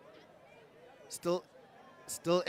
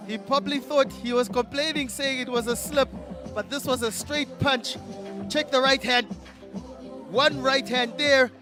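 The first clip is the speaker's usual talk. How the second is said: excited commentary; 5 semitones higher, 3 dB louder than usual. Real, not acted.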